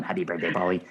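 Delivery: a light imitation of a stiff, unnatural robot greeting; stilted robot voice. Robot greets Paulie on his birthday, stilted and unnatural. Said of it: "it's very, like, stilted and weird and unnatural".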